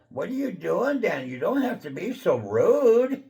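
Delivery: high, indignant imitation of his fiance; mock whiny fiance. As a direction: squeak it out like she's the one offended. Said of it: "and she go".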